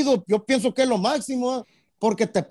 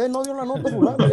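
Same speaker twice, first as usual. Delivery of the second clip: laughing mock quote; 3 semitones higher, faster than usual.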